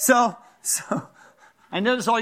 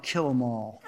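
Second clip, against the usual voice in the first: mock gruff verdict; 8 semitones lower, 6 dB quieter than usual. His own attitude to spiders.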